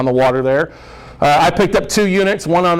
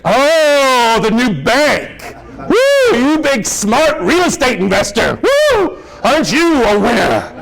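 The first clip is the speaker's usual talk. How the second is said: nagging wife; 7 semitones higher, much slower than usual. Retold or imitated. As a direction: sneer like a scornful wife. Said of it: "And his wife overhears".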